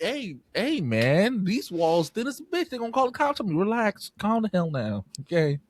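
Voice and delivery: high, urgent mock pleading with a baby; playfully frantic parent, pitched high; mock exasperated pleading. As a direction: beg a screaming infant to calm down, comically. Pleads with screaming baby to hush.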